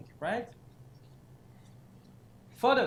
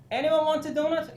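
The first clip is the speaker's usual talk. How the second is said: mock cheerful offer; 8 semitones higher, 6 dB louder than usual. Voicing each platform's donut post.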